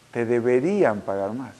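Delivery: slow, soft echo of a claim; eco reflexivo. Retold or imitated. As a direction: repeat it slowly and quietly, weighing it. Repite pensativo la frase de ella. (repetición lenta, suave y reflexiva)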